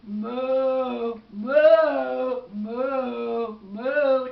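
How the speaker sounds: loud, drawn-out moos; booming imitation of cattle; cow mooing. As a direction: moo loudly like a cow. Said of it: "the cow was making cow sounds".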